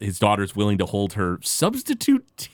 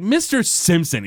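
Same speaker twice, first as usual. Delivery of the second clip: frustrated; 7 semitones higher than usual. Retold or imitated. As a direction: snap the name out in exasperation. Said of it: "he's getting frustrated".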